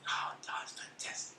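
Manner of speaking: slow, hushed whisper; retold whisper. Imitating the admired man's reply, slow whisper. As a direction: whisper it slowly, imitating him.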